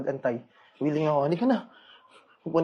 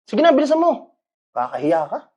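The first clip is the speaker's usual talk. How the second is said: contemptuous; 7 semitones higher, 6 dB louder than usual. Acted. Son dismissing mother with contempt.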